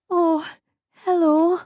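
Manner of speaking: high, tearful whimper. A narrator's reading. Mother meeting her baby, tearful whimper. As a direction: greet her through tears. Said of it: "I whimpered".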